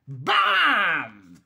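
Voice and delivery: loud punchy bam; loud comic bam as he walks into a tree; bam sound effect. A narrator's reading. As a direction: smack the word like a bump. Mister Bump hits a tree.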